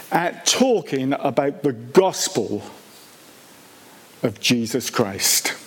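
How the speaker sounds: ordinary talk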